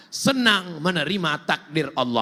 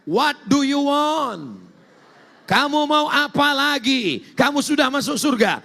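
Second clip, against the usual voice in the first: high, proclaimed questioning; booming divine question; 8 semitones higher, 3 dB louder than usual. Acted. Voices Allah's question in translation.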